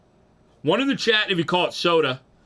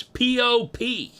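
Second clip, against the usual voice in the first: spelled out slowly; 9 semitones higher, 3 dB louder, much slower than usual. Shown as a demonstration. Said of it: "I don't even know how to spell soda".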